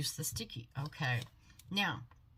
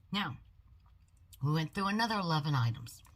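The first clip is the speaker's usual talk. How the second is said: ordinary talk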